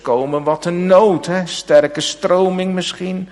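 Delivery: preaching at full force, high and loud; fervent preaching. Acted. Preacher pressing the congregation about crying out to God, fervent full-force preaching. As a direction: drive the sermon home with rising urgency.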